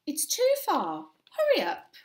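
voiced mum chivvying her child; hurrying mum. A narrator's reading. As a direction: hurry the child along, pitch lifted. Mum hurrying Oliver for bus.

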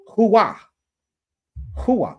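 "Hua" as an emphatic hoo-WAH; pronunciation demo. Shown as a demonstration. Streamer tries the corrected word, emphatic "Hua". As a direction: punch out "Hua" with a big open ending. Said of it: "I like my pronunciation better".

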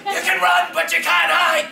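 loud, high Australian villain shout; Road Warrior Australian. Retold or imitated. Voicing the Road Warrior bad guy, Australian shout. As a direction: bellow it like an Aussie wasteland punk. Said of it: "So we made him sound like that".

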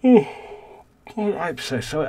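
spoken mid-yawn, slow and stretched. Real, not acted. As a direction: yawn through the words. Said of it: "I've got the yawns".

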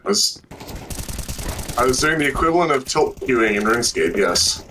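hollow echoing mic; echoing tunnel. Real, not acted. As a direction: voice echoing in a big room. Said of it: "Why do you sound like you're in a tunnel?".

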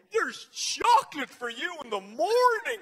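high-pitched voice from inside a rabbit head; giant rabbit voice. Acted. Father as Easter Bunny to his son, high rabbit voice. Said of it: "without the mouth moving".

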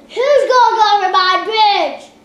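loud troll challenge; booming troll. Acted. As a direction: bellow it like a troll.

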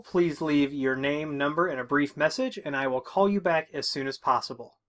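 ordinary talk, nothing marked

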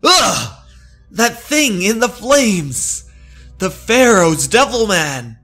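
loud, high terrified cry. Acted. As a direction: scream at the thing in the flames. Todd sees a monster in the fireplace.

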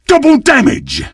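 high, excited call-out; high-pitched triumphant announcement; thrilled power-up call. Acted. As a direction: crow it with greedy glee. Grabs a power-up.

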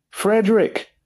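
high-pitched demand of a name; stern demand. A narrator's reading. Father confronting his son about the girl, demanding. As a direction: pull him up with a high, insistent call. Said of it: "he demanded".

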